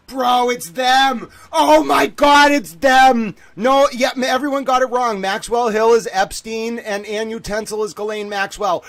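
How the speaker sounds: real shout of discovery, high and loud; excited shouting. Real, not acted. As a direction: yell it out as the pieces click into place. Streamer realises the conspiracy live.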